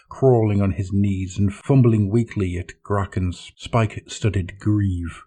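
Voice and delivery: plain reading voice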